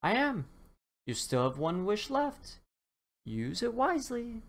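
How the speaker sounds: high, firm leprechaun; a knowing leprechaun warning. Acted. Leprechaun reminds the dinosaur of his last wish, high and firm.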